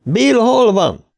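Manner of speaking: high, drawn-out call; slow, high-pitched searching shout; shouted question. A narrator's reading. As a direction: holler the question down a pipe. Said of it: "kiáltotta Richie".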